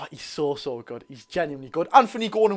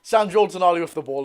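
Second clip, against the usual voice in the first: usual speaking voice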